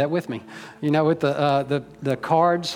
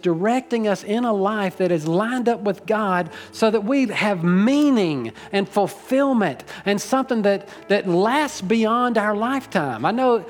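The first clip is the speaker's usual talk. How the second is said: higher-pitched, building sermon delivery; fervent preaching; 4 semitones higher than usual. Acted. Pastor urging the congregation toward God, fervent preaching. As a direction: lift the pitch and drive the message home.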